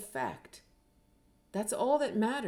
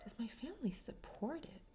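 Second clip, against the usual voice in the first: hushed, much softer private musing; inner thought; 4 semitones higher, 11 dB quieter than usual. Retold or imitated. Trainee silently questioning family support in the circle, hushed inner thought. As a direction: ask it under your breath, as if to yourself. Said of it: "I'm sitting there thinking".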